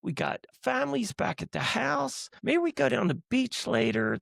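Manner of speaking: high-pitched impression of a thrilled buddy; imitated elation. Imitating a friend celebrating on the boat, high and excited.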